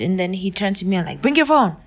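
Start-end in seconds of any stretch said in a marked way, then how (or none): 1.2-1.8 high, loud threatening order; demanding. Retold or imitated. Gunman demanding the phone, loud and threatening. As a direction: demand it with menace.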